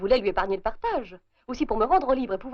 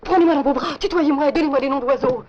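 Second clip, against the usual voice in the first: urgent; 5 dB louder than usual. Acted. Urgent staging before husband arrives.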